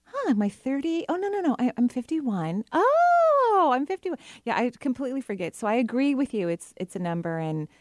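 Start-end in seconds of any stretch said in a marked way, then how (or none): 0.0-4.2 mock shock at own age; gasp, then remember your age; surprised realization. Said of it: "it's just like".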